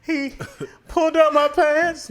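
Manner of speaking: high, drawn-out mock confession; haltingly confess, hammed up for laughs; slow, high pretend victim voice; mock tearful confession.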